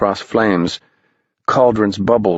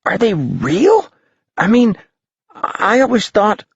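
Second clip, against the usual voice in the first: put-on high, unsure kid; a boy questioning what he sees; higher young voice; doubting boy; 12 semitones higher than usual. A narrator's reading.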